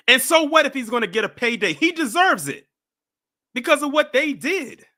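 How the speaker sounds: loud, high, fast comeback; heated retort. Real, not acted. Defending the man's payday against the other host, heated. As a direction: fire it back in outrage.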